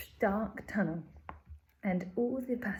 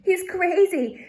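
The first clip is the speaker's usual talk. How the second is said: high, loud and slow alarmed shout; 10 semitones higher, 8 dB louder, slower than usual. Acted. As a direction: yell it in a panic. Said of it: "they shouted".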